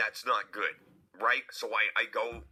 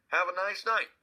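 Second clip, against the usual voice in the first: cheery high sign-off; 9 semitones higher, 4 dB louder than usual. Retold or imitated. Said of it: "he's like".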